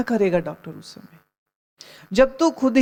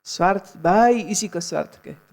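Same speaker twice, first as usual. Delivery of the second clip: ordinary talk, nothing marked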